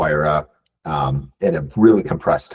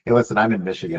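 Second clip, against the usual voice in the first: normal talking voice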